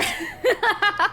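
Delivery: bursts into a high burst of laughter; cackling with glee after the session ends; loud high laugh. Real, not acted.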